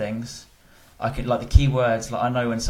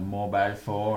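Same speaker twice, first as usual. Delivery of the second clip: put-on slow, laughing Indian accent; 3 dB quieter, slower than usual. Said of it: "in an Indian accent".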